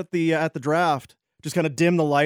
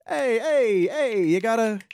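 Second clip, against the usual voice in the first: try-hard comedian; 7 semitones higher than usual. Pretend. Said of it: "You gotta deliver the goods".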